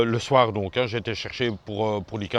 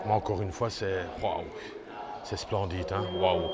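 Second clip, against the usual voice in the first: quiet awe; 5 semitones lower, 7 dB quieter than usual. Real, not acted. Awed inside a sacred cave.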